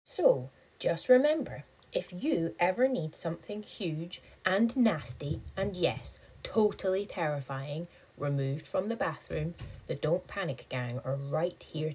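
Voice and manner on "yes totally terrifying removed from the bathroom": ordinary delivery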